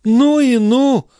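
high, loud, drawn-out dismay; chiming-in reproach. A narrator's reading. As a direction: join in with exaggerated indignation. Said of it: "подхватила Шон".

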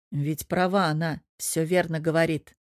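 usual speaking voice

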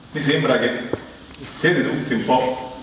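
usual speaking voice

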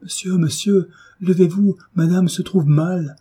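high, urgent call for help; panicked servant calling out; alarmed cry. A narrator's reading. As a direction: raise the alarm, pitch well up. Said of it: "s'écria en courant à la chambre de Frédéric".

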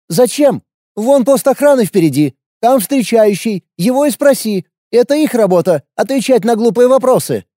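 wary fighter, blunt and guarded; young warrior woman. A narrator's reading. Lina answers dismissively.

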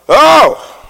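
high ecstatic whoop; sudden shout. Real, not acted. Preacher to congregation, an ecstatic shouted oh. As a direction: let out a joyful cry.

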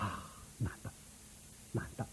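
very soft, murmured wonder; low, breath-like appreciation; hushed admiration. Acted. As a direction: marvel under your breath. Prince marvels at rare coin.

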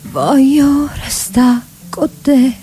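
ordinary delivery

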